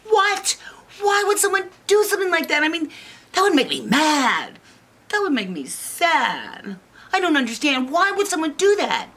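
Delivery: put-on high-pitched, upset little girl; Goldilocks. Girl appalled that someone discards a toy, high indignation. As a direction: protest quickly in a high indignant voice. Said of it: "And Goldilocks was like".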